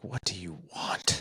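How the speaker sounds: whispered, slow, surly "What do you want?"; mock bratty kid; rude whisper. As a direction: mutter it rudely under your breath. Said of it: "So you're not like".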